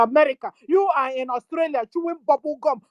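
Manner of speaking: ordinary delivery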